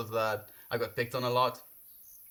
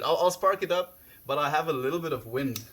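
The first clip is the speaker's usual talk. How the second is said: excited; 4 semitones higher, 5 dB louder than usual. Real, not acted. Streamer answering viewers excitedly.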